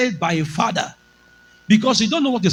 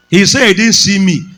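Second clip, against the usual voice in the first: ordinary talk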